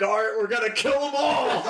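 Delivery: high, hammed-up war cry; rally the troops with comic bloodlust; gung-ho battle cry. Player as character rousing everyone to kill, gleeful battle cry.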